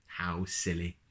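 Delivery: plain reading voice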